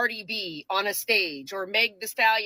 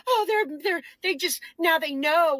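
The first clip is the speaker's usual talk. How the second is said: higher-pitched, stammering mimicry; mock excited video-maker; 6 semitones higher than usual. Mocking the videos you guys are making, high mock excitement. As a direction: imitate a flustered gloater, pitched up. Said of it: "videos you guys are making going".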